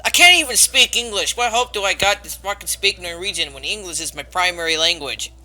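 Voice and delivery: ordinary talk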